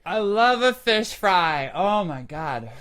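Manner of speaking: loud, high, drawn-out rapture; gushing excitement. Real, not acted. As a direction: burst out with real love for it. Gushing over fish fry.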